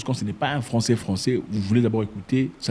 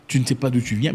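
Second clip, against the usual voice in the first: ordinary delivery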